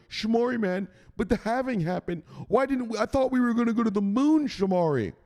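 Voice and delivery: high mock whine of a disappointed fan; mocking whiny viewer. Mocking a viewer who believed the halving, high whine. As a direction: whine like a naive crypto kid. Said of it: "I know".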